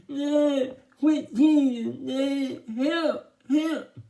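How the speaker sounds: high, loud muffled wail; stuck-lipped protest. A narrator's reading. Byron protests Dad's teasing, high muffled wail. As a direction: whine for help through stuck lips. Byron with lips frozen to mirror.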